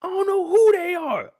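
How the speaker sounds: disown them with high-pitched contempt; indignant old man. Acted. Character denies the nephews.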